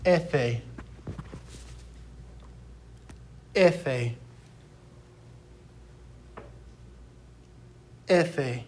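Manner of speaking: Efe said EH-fay, falling, spaced apart; slow model pronunciation. Shown as a demonstration. Teacher has students repeat the letter after her, clear demonstration. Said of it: "So repeat after me".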